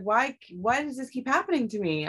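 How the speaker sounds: exasperated. Retold or imitated. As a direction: complain as if baffled by bad luck. Retold upset reaction to betrayal.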